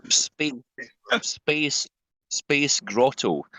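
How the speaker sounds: American stumbling slowly over the Scottish words; a lightly voiced American puzzling out a Glaswegian phrase; hesitant slow attempt. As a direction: feel your way through each word, unsure. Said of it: "And he's like".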